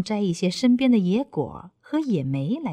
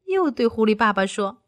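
ordinary delivery

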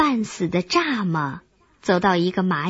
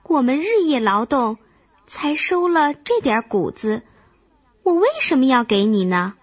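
higher, indignant scolding that speeds up; angry questioning; 6 semitones higher, faster than usual. A narrator's reading. Hard-working ant challenges the begging grasshopper, angrily. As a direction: demand an answer, mildly cross. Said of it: "蚂蚁生气地问道".

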